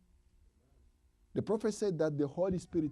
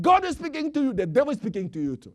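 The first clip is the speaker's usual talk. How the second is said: higher, louder, fast repeated warning; retold loud call; 7 semitones higher, 9 dB louder, faster than usual. Preacher retelling a brother's urgent repeated warning. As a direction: shout it like the brother kept saying it. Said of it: "He was saying that over and over".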